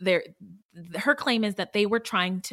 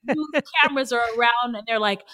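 usual speaking voice

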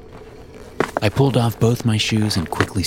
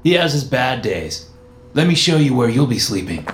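put-on higher, louder young man; dismissive higher young man; 6 semitones higher, 3 dB louder than usual. Jerry voiced.

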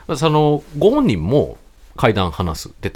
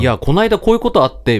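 casual, higher-pitched anecdote opener; light, breezy storytelling; 7 semitones higher, 4 dB louder than usual. Retold or imitated. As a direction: imitate someone launching lightly into a chatty story. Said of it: "軽快なテンポで".

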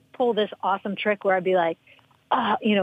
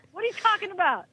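much higher, incredulous demand; mock disbelief at the prank; baffled; 11 semitones higher than usual. Pranked teammate to coach, incredulous. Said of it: "She's like".

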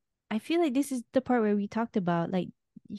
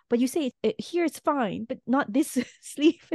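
higher-pitched imitation of a nitpicking objection; mock quibbling; 6 semitones higher than usual. Co-host voices wife arguing dress rules, mock quibbling. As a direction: pick apart the rule point by point, half laughing.

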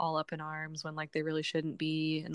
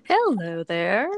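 sultry nasal voice; 11 dB louder, much slower than usual. Real, not acted. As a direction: drawl it out, husky and sultry. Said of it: "Do you like my sultry, nasally voice?".

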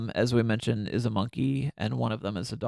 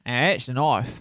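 put-on a quick, light Crocodile Dundee-style Australian accent; playful mock Australian accent. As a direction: toss off the knife line in a half-hearted Australian accent. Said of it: "Sorry, Australian listeners. I try not to do any sort of accents".

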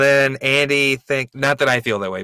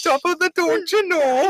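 laughing Irish-Midwest mashup, much higher; mixed-up accent. Pretend. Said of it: "You can't do that".

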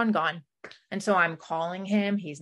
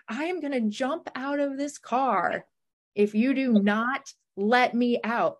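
imitated threat; 6 semitones higher than usual. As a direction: lean on each word like a warning. Boyfriend threatening in the car.

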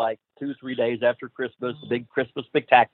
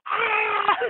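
very high mock scream of panic. Rip mimics his own scream in the falling plane. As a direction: shriek like you're falling.

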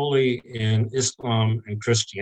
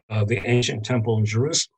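usual speaking voice

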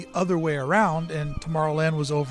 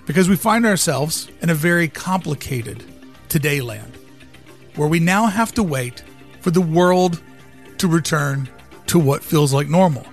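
dramatic speech; 5 dB louder than usual. Acted. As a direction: deliver it like a keynote pitch. Dramatic visionary monologue over music.

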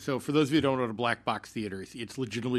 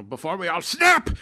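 hammed-up German accent, bursting louder on the last word; horrible German accent; 4 semitones higher, 6 dB louder than usual. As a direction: overdo a terrible stage German. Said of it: "really German it up at some points".

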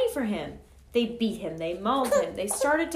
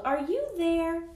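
slow, higher call; 5 semitones higher than usual. A narrator's reading. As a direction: call out searching.

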